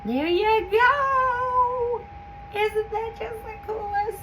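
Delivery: high, very slow, awed delight. Real, not acted. Admiring the filled jar, delighted. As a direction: savour every word in wonder.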